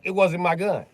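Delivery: high, loud indignant denial; defendant protesting. Acted. Defendant on trial protesting loudly. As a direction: insist it's not your gun.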